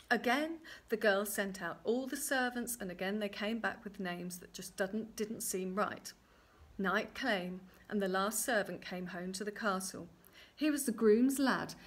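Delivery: plain reading voice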